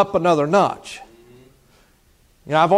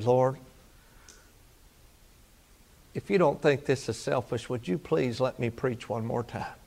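hushed prayer; 6 dB quieter than usual. Retold or imitated. Retold frightened prayer in helicopter.

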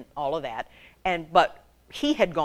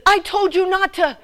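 high, loud husband's rebuke; loud mock-husband scolding; exasperated scolding; 11 semitones higher, 8 dB louder than usual. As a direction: yell an I-told-you-so, cut short. Husband scolds after keys are lost.

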